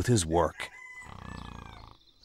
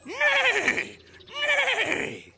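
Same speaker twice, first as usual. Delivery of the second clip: very high whinnying neigh; whinny like a horse. A narrator's reading. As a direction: horses calling to the farmer. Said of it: "said the horses".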